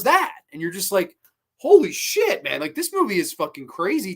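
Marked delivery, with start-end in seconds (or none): 1.6-2.6 loud, high-pitched mock shock; stunned disbelief. Viewer sees the villain turn, stunned.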